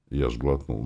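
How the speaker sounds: ordinary delivery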